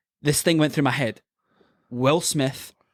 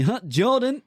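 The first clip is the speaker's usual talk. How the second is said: high English phone voice, imitated; English accent; 10 semitones higher, slower than usual. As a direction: greet him as the English caller. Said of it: "Your English accent is fucking spot on".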